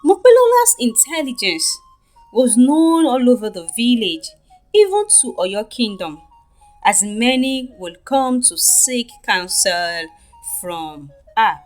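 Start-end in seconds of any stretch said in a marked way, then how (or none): none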